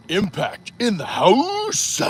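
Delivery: high, slow hype shout; emphatic. Shown as a demonstration. Reading a viewer's emphatic comment, slow high hype shout. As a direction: belt it like a ring announcer. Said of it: "This is very emphatic".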